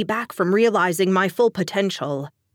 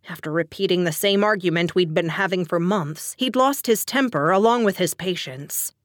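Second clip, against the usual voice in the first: ordinary delivery, nothing marked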